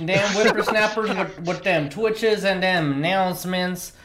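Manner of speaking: put-on cranky old man rant; shouting at trespasser. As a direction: grumble like an angry geezer. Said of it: "We're doing a bit".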